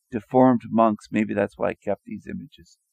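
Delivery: ordinary talk, nothing marked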